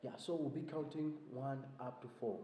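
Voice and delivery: usual speaking voice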